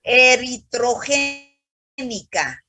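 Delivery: "Eritrogénica" stretched out very slowly, syllable by syllable; teacher's slow-motion model of the word; slow corrective enunciation. Shown as a demonstration. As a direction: draw out every syllable of "Eritrogénica" to correct a student. Teacher corrects student's misread term.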